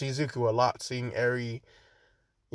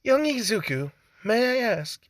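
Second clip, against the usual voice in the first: high, put-on British accent; polite British character, pitched up; Nezu; 5 semitones higher than usual. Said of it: "I don't know why I made him British".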